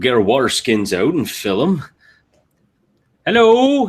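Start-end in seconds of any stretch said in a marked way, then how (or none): 3.3-3.9 high, loud hello; hailing someone from afar; calling out. Acted. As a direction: call out brightly across a distance. Calling out to a stranger.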